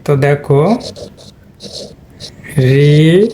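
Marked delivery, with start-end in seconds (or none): 2.6-3.3 first syllable of রিজেক্ট held long, reee. Shown as a demonstration. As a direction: draw out the ree of রিজেক্ট while spelling it. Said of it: "এটা যদি আমি একটু বাংলা লিখি".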